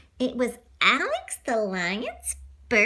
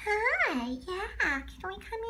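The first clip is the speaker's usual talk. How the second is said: higher, much softer sheepish ask; meek plea; 8 semitones higher, 7 dB quieter, slower than usual. Acted. Alex timidly asking the circus animals to let them in, high and soft. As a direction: ask shyly to be let aboard. Animals begging onto the train.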